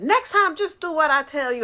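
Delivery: high, loud bossy order; sister scolding. Retold or imitated. Dead sister scolding her, loud. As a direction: lay down the law.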